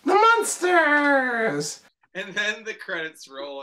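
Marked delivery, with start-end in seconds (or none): 0.1-1.8 very high, very slow "The Munsters!"; theme announcer. Retold or imitated. The movie's opening titles, drawn-out announcer cry. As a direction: stretch it like a title card. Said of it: "It goes".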